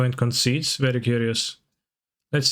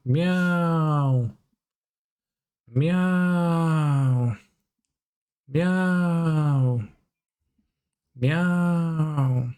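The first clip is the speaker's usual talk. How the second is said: drawn-out higher meows, each falling; mock cat meows; 4 semitones higher, much slower than usual. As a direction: meow like a cartoon cat. Playing a cow who says meow.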